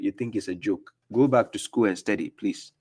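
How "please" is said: normal talking voice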